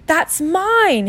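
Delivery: put-on high-pitched protesting boy; Harry. Boy tries to snatch his letter back, high protest.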